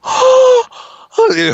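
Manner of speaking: high gasping "Oh, oh" in dismay; daughter's dismayed gasp. Retold or imitated. Father imitating daughter upset at Chewie eating a porg. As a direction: gasp high and loud like a hurt child. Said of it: "She was like".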